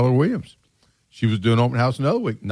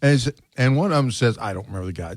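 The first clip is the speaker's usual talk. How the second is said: normal talking voice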